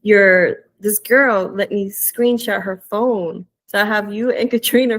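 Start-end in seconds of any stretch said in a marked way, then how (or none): none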